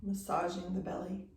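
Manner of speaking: unhurried yoga-teacher meditation voice, long pauses between phrases; guided meditation. Real, not acted. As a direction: guide them gently into stillness, leaving space after each phrase. Leads guided breathing meditation.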